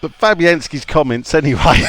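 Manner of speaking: ordinary talk, nothing marked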